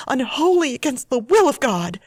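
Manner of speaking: usual speaking voice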